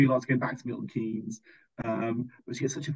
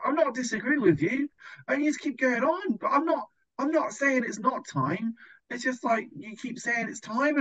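mocking whiny protest; 9 semitones higher than usual. Imitating the camper who argues about bedtime, high and whiny. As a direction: whine it like a sulky teen who won't let it go.

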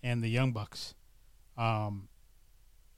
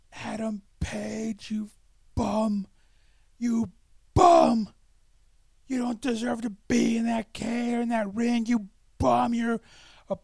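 drawn-out high, loud yelling at a wrestler; screaming heckler; 10 semitones higher, 8 dB louder, slower than usual. Retold or imitated. Angry fan heckling Adam Page from the crowd, screaming. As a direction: imitate a fan bellowing at full lungs, words stretched out. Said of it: "screaming at the top of his lungs".

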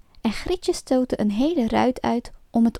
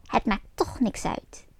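softer whispered witch voice; 7 dB quieter than usual. A narrator's reading. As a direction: hiss it coldly. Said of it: "zei de oude heks".

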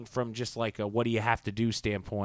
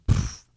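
mock scoff; 7 dB louder than usual. Fair guy scoffs.

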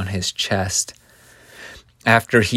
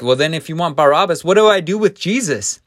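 quick, higher-pitched questioning of a crowd; exasperated Pilate; 7 semitones higher, 5 dB louder, faster than usual. Acted. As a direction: act out Pilate asking, pitch climbing fast. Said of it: "And Pilate is like".

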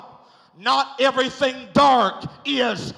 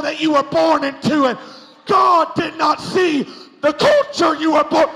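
loud, rising preacher's shout; preaching at full force; 3 semitones higher, 5 dB louder than usual. Acted. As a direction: hammer each line home, louder.

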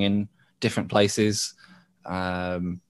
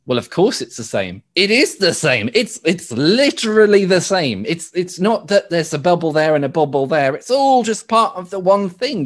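louder, higher, hammering the point home; emphatic insistence; 6 semitones higher, 4 dB louder than usual. Acted. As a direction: insist on it, leaning hard on key words. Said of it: "drives that point home".

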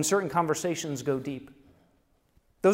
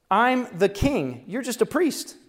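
mock arrogant king; 7 semitones higher, 5 dB louder, slower than usual. King rebuffs the priests.

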